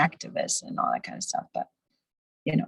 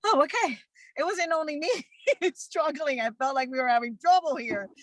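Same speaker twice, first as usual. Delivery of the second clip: higher, laughing imitation of a therapist; high-pitched mock therapist voice; relieved therapist; 8 semitones higher than usual. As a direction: act out a therapist glad to be told. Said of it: "how many therapists appreciate that and go".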